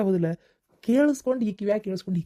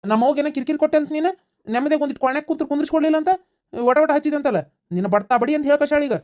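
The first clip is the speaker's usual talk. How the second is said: mock scolding; 8 semitones higher, 4 dB louder, faster than usual. Husband confronts wife for mother.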